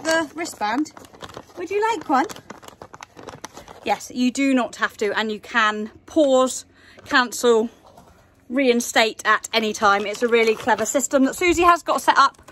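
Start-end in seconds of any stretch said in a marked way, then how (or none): none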